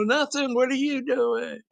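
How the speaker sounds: high singsong mock holler; neighbors calling back. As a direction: imitate someone calling across a building, drawn out. Acting neighbors calling across building.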